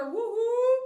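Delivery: high, loud woohoo; excited cheer. Real, not acted. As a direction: whoop for the new member. Cheering a new member.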